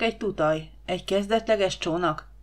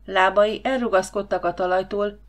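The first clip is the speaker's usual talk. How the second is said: ordinary delivery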